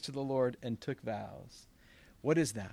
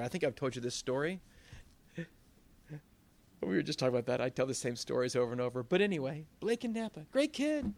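usual speaking voice

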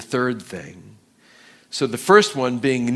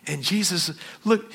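normal talking voice

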